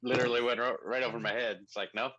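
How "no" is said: ordinary talk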